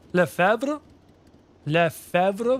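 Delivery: "Lefebvre" as "le-FEV-ruh", B silent. Shown as a demonstration. Streamer reading French surnames to chat. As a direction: drop the B in "Lefebvre". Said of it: "the silent B".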